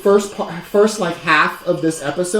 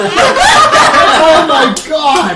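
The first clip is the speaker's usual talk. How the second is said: laughing; 7 semitones higher, 8 dB louder, much slower than usual. Real, not acted. Spicy drink reaction.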